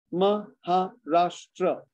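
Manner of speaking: MAHARASHTRA slowly in four beats: Ma, ha, rash, tra; syllable by syllable. Shown as a demonstration. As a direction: count out Maharashtra with gaps.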